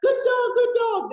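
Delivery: very high, loud, gushing praise; happy praise. Shown as a demonstration. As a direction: reward the puppy with a squeal of delight. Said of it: "you're gonna go".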